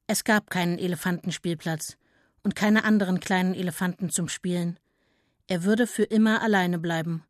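ordinary delivery, nothing marked

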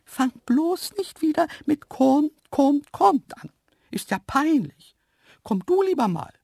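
high-pitched, loud antelope voice; hissed scolding. A narrator's reading. Antelope telling off the man for luring, scolding hiss. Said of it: "da zischte es".

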